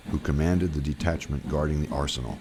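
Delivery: ordinary delivery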